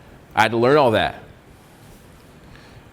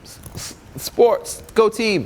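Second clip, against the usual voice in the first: much higher, slow mock awkward voice; awkward nervous self; 9 semitones higher, slower than usual. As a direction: play your younger self, lost and unsure. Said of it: "I'm like".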